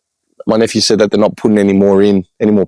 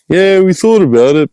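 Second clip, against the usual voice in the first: mimicking the nephew; 9 semitones higher, 3 dB louder than usual. Nephew voiced in retelling.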